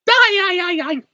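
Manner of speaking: very high, loud cartoon squeal; SpongeBob. Streamer attempting SpongeBob on request, high cartoon cry. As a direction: fire it off in a piercing cartoon voice. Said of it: "SpongeBob, um, I can give it a try".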